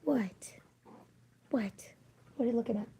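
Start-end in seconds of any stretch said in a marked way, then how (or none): none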